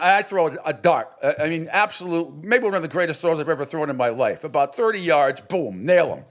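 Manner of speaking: usual speaking voice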